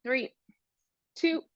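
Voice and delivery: talking with a dry mouth; dry-mouthed, sticky speech. Real, not acted. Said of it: "my mouth is extra dry inside".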